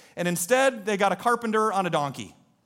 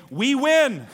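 higher, louder cry of triumph; acted shout of victory; victorious cheer; 6 semitones higher, 5 dB louder than usual. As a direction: crow it like a winning crowd. Voices crowd cheering victory.

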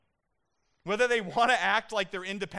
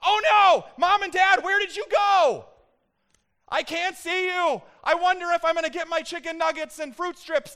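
put-on much higher, loud, panicked toddler cry; 12 semitones higher, 11 dB louder than usual. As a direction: wail like a two-year-old who thinks he's been abandoned. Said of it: "he's not waking up screaming out".

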